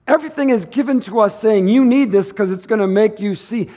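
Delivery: high, loud, driving sermon voice; fiery preaching. Acted. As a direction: preach it out to the church. Preaching at full force.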